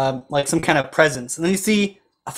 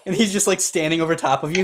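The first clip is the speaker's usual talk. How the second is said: normal talking voice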